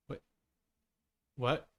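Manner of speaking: normal talking voice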